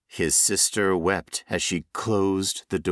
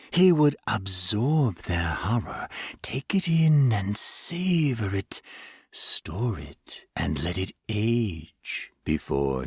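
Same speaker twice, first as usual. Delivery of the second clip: whisper. Acted. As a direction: breathe it out like a secret delight. Creature savoring memory.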